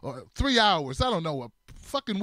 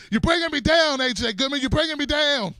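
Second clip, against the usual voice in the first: mock angry yell; 7 semitones higher, 5 dB louder than usual. Shouting at friend, joking attack.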